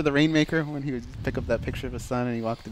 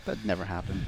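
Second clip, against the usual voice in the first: usual speaking voice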